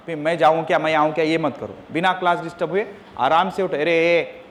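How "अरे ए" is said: normal talking voice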